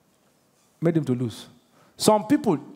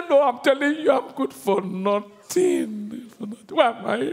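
mock crying. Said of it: "you start crying".

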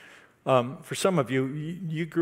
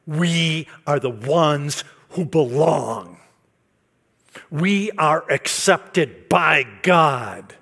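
slow, louder proclamation; emphatic preaching; 3 semitones higher, 8 dB louder, much slower than usual. Acted. Preacher assuring the congregation, slow and emphatic. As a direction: announce it as a promise.

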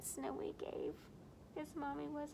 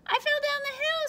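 put-on much higher, louder kid voice; little bear's fib; 9 dB louder than usual. Snowy fibbing to Mommy about the snow, sweet and high. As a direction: squeak out the excuse sweetly. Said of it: "Snowy said".

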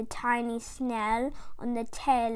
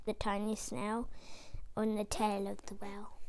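hushed for the tiny snail; 4 semitones lower, 10 dB quieter, faster than usual. A narrator's reading.

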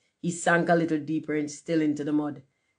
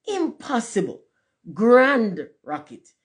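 high outburst, second part slow and loud; indignant exclamation; 6 semitones higher, 5 dB louder, slower than usual. A narrator's reading. Rocket correcting what the man said, indignant. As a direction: refuse to believe it, exclaiming.

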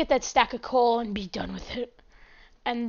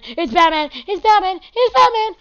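louder and higher with each repeat; mock excited shout; 7 semitones higher, 8 dB louder than usual. Gamer spots a bat in the cave, escalating excited shout. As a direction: build to a high, loud yell.

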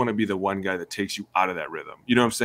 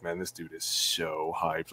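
slow, much softer aside; awed hush; 4 semitones lower, 9 dB quieter, slower than usual. Retold or imitated. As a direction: marvel quietly, slowly. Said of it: "be like".

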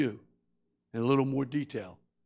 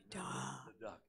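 sly whisper; 3 semitones lower, 17 dB quieter, slower than usual. Acted. Sister's whispered reminder.

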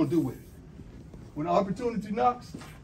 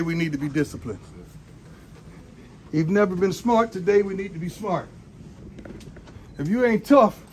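pep talk. Real, not acted. Coach addresses team.

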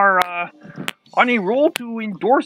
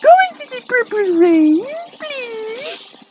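frog voice; 12 semitones higher, 4 dB louder, slower than usual. Acted. Cheering purple rain.

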